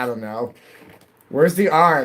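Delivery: ordinary talk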